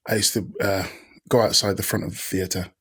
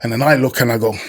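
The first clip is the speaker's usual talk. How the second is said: ordinary delivery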